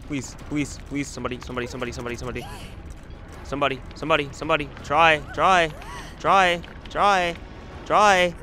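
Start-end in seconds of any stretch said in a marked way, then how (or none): 0.1-4.7 softer desperate begging; quiet pleading. Real, not acted. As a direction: beg under your breath. Begging while hooked.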